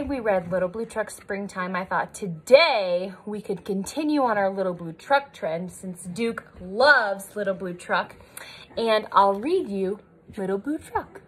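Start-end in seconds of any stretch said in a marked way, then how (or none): none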